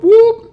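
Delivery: high loud siren imitation; siren whoop. A narrator's reading. Narrator imitating a police siren, loud whoop.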